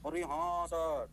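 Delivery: very slow, stretched-out pupil's assent; imitating students, long drawn vowels; mock student agreement. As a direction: drag out each word as the class agreeing.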